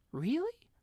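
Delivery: a little mouse unsure whether to believe it; doubtful mouse. A narrator's reading. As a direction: squeak out your doubt.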